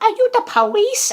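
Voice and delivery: high, loud mock-girl question; imitated girl voice. Girl questioning the burglar, imitated high voice. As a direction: pitch it way up like a girl.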